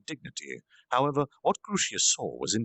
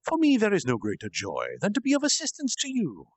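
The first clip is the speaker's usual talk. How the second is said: higher, eager servant voice; devoted devils; 10 semitones higher than usual. Acted. Greater devils kneeling before their lord, devoted and eager. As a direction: offer yourself gladly.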